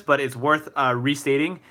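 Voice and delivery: ordinary talk, nothing marked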